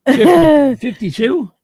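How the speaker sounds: high, loud questioning; surprised. Real, not acted. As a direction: sound amazed at the number. Mock questioning age.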